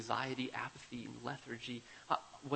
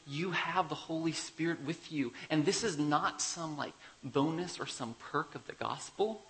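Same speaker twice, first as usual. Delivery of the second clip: ordinary talk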